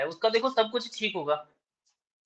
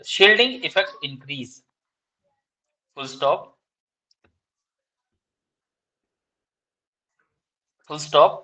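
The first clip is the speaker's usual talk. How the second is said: slow, deliberate dictation, each phrase spaced out; 4 semitones lower, much slower than usual. Shown as a demonstration. Teacher dictating exam answer to students, slow dictation. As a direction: read out notes word by word for students to copy.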